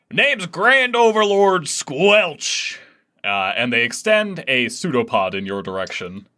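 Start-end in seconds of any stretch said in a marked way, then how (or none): 0.1-2.8 slow, higher and louder, proud announcement; grand pompous title. Acted. Squelch proudly names himself to the crew, slow and grand. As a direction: roll the title out slowly and grandly. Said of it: "run that by us one more time".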